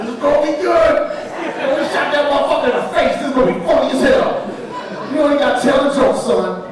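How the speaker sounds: hammed-up cackling triumph; gleeful laughing outburst. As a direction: crow with delight through laughter. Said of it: "He, like, laughed".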